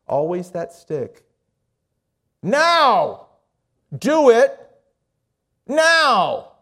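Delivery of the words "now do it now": loud, high shouts with pauses between; shouted command. Retold or imitated. Monk's voice still following the man years later, shouting. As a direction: hurl each word out as a separate order.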